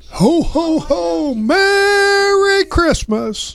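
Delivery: booming high Santa laugh, slow Merry Christmas; big jolly Santa bellow. Acted. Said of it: "can you give us one of your ho, ho, ho's".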